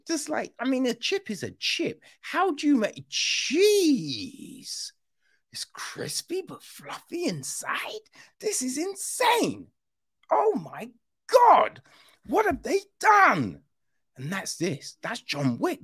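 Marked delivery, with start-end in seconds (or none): 0.1-4.9 high, breathless astonishment rising to a yelp; mock amazement. 5.5-8.1 high, breathy whisper of wonder; awed whisper. Retold or imitated. Awed by crispy chip. 8.4-13.6 high, breathless astonishment rising to a yelp; mock amazement.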